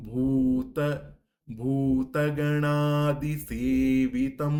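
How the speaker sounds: ভূত with a held long ভূ, slow and loud. Shown as a demonstration. Teacher showing long vowel ভূ in ভূতগণাদিসেবিতম, slow and loud. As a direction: draw out the ভূ in ভূতগণাদিসেবিতম. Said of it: "ভয় দীর্ঘ রয়েছে".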